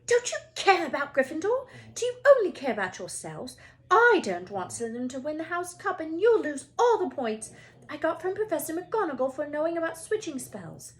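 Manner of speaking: high, loud, scolding Hermione; angry goose hiss. Acted. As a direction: nag them like an angry goose. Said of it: "hissing at them like an angry goose".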